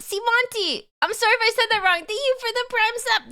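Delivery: high sweet thank-you voice; cutesy high voice. Acted. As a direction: pitch way up and sweet.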